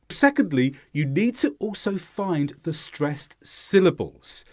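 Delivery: ordinary talk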